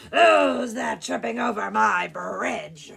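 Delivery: slow, very loud troll bellow; roaring troll. Acted. Troll challenges the second goat, slow roar. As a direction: roar it out slowly and fiercely. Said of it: "roared the troll".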